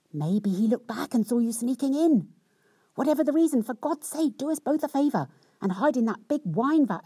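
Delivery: a flustered wife hurrying her lover into hiding; lightly hammed-up alarmed young wife; panicked wife.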